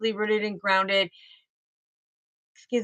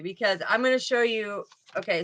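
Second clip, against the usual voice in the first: usual speaking voice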